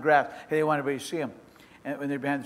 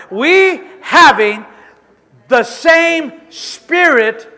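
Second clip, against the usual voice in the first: slow, drawn-out, louder sermon ending; preaching climax; 6 semitones higher, 9 dB louder, much slower than usual. Acted. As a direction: stretch each word out for the crowd.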